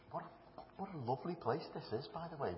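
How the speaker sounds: ordinary talk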